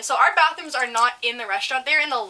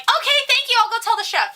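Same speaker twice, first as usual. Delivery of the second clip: very high, sing-out customer-service voice; brightly fob off a complaining customer, high-pitched; sky-high polite server act; chirpy server reply; 11 semitones higher, 4 dB louder than usual. Retold or imitated.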